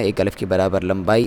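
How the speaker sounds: normal talking voice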